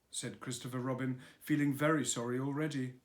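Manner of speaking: plain reading voice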